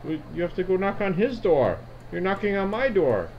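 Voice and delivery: put-on high, tetchy old lady; old woman. Old woman telling the party to knock elsewhere, high and tetchy. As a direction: set them straight, high and tetchy. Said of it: "And she says".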